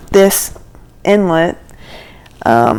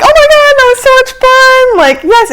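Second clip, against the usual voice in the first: very high, squealed retelling; giddy excitement; 14 semitones higher, 3 dB louder than usual. Retold or imitated. Passengers answering the pilot after the dive, giddily thrilled. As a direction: squeal it high, as if just off a ride.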